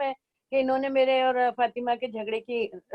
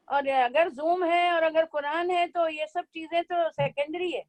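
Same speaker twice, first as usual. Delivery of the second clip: ordinary talk, nothing marked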